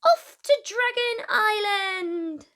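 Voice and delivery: usual speaking voice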